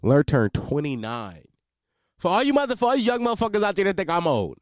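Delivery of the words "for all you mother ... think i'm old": calling out. Real, not acted. Calls out to young listeners.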